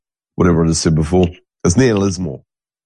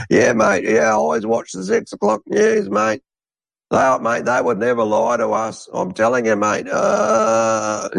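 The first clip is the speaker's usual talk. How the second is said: much higher, put-on voice of a trusting old bloke; mocking old Aussie bloke; 13 semitones higher than usual. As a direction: parody a man who believes the six o'clock news. Mocking news-believing old bloke.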